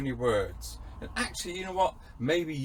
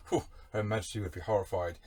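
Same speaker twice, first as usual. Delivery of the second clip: normal talking voice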